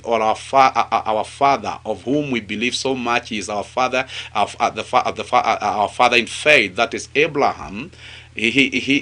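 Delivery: normal talking voice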